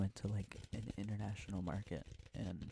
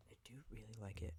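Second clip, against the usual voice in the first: whispering; 7 dB quieter, faster than usual. Real, not acted. ASMR whisper video.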